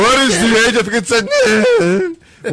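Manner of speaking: high, breaking mock crack; voice cracking up an octave. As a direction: let the voice jump up and crack. Repeating the question, mocking the crack.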